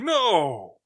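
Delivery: mock-anguished yell, high and falling; drawn-out, loud storybook "no"; dramatic cry of no. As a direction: cry out in hammed-up protest. Acted dramatic cry in retelling.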